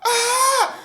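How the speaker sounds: high piercing mock scream of a panicked teen. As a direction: shriek it in a hammed-up teen panic. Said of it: "she's, like, scre".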